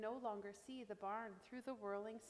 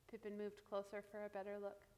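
plain reading voice

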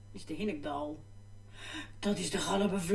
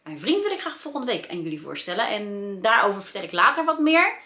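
ordinary talk

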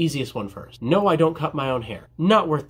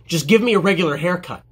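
irritated customer, firm and a bit louder; imitated impatient yell, mildly raised; mock yelling; 3 semitones higher, 4 dB louder than usual. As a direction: snap it like a fed-up customer. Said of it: "why did dude yell at me?".

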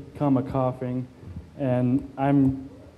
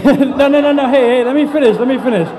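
loud, high, laughing protest; 9 semitones higher, 6 dB louder than usual. Real, not acted. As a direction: laugh while shouting them down. Cutting off heckling crowd, laughing.

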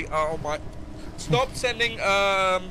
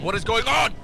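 raised, higher-pitched outburst; near scream; 5 semitones higher, 5 dB louder than usual. Real, not acted. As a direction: burst out louder, rising at the end. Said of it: "Don't scream".

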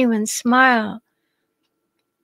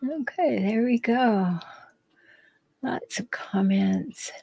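whispered aside; 9 dB quieter, slower than usual. Real, not acted. Reading the chat aside.

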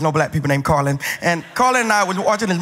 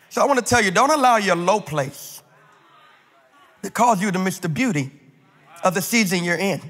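preaching; 3 semitones higher than usual. Acted. Pastor preaching to the congregation in a rising cadence. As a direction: preach it, building each line.

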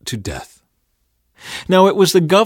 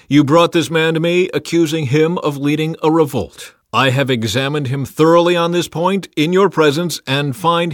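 loud, raised public pronouncement; announcing a verdict; 5 semitones higher, 6 dB louder than usual. A narrator's reading. Pilate addressing priests and people, announced verdict. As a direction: declare the ruling to all. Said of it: "he announced his verdict".

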